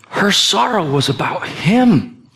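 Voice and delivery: slow, hushed sincere preaching; tender reflection. Acted. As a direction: let it sink in gently.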